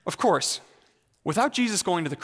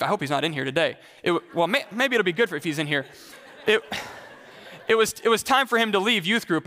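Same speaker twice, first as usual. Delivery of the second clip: ordinary talk, nothing marked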